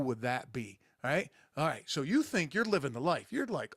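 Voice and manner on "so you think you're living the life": ordinary talk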